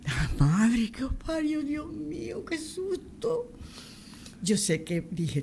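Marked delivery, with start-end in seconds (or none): 0.1-3.5 high, slow cry of fright breaking into a sob; frightened cry. Retold or imitated. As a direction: let the scare pour out, almost crying. Pranked, reacting to the fright. (grito asustado, casi llorando, tras la broma)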